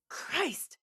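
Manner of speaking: pained gasp. Acted. Fighter hurt in combat, high pained gasp. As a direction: gasp it out as if struck.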